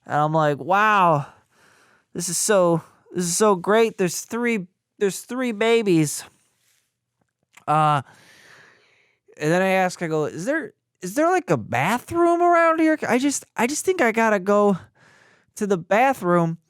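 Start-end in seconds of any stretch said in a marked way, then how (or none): 0.6-6.2 high, halting, overwhelmed mock joy; forced delight. Dazed father reacting to three babies, high forced delight. Stunned dad forcing delight at triplets. 10.3-16.6 very high, stammering, queasy-dad voice; mock panicky plea. Overwhelmed father asking tech for bathroom, high mock panic.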